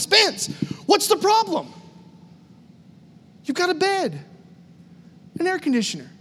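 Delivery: high-pitched, exasperated questioning; incredulous chiding. Retold or imitated. Spirit voiced chiding the preacher.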